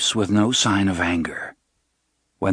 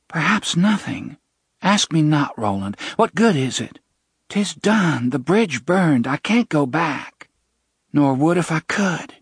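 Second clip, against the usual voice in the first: put-on young woman pleading; 7 semitones higher than usual. Jenna pleads with Roland.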